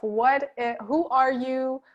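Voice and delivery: usual speaking voice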